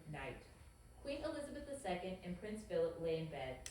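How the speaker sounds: normal talking voice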